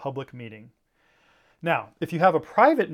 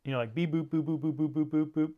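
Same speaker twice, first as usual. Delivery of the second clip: gibberish babble; 3 dB quieter than usual. Shown as a demonstration. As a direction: beep boop nonsense syllables. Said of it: "not like gibberish".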